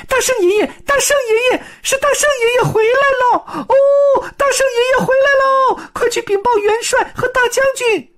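very high, loud, excited little monkey voices; small monkeys shrieking happily; little monkeys shouting. Acted. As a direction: squeal with joy that the Great Sage is back.

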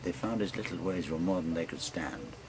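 rolling bush-poem recital, relishing every line; ballad recitation. Acted. Storyteller reciting a bawdy ballad to friends, in a rolling recital voice.

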